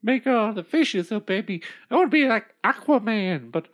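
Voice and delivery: angry bossy queen. Acted. Queen orders husband.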